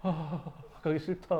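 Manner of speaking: small, soft dreading murmur; hushed, unwilling retold mumble; subdued reluctance. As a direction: mimic trainees gone quiet with dread. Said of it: "애들이 갑자기 말이 없어져".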